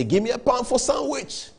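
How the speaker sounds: a light imitation of a begging voice; imitating a beggar. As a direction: put on a London street beggar asking for change. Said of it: "That's how they say it".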